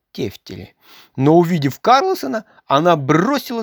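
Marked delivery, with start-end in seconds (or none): none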